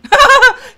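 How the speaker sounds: shrieking laugh; big loud laugh; loud burst of laughter. Real, not acted. Mother laughs at impression.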